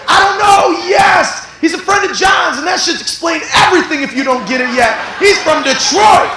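high loud comic yell; laughing shout. Real, not acted. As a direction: shout the punchline over the laughter. Preacher shouting laughing reply.

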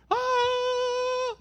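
sudden high yelp; high cry. Acted. Comic cry after impression.

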